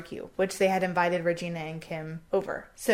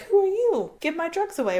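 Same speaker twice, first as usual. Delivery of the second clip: mock angry confrontation; 10 semitones higher, 3 dB louder than usual. Angry friend confronts over drugs.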